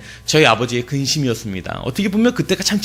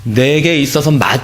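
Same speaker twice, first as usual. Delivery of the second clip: usual speaking voice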